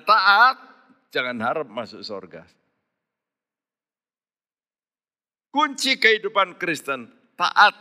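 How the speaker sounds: high, pleading preacher's cry; high impassioned sermon voice; fervent preaching. Acted. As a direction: urge the congregation to pray hard. Preaching at full force.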